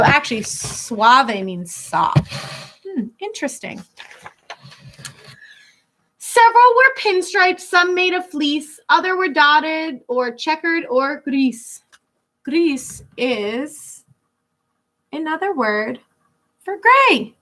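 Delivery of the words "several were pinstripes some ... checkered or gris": put-on lilting read-aloud for kids; storybook reading. Teacher reading a rhyming picture book to children, lilting. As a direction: read the rhyme to a class.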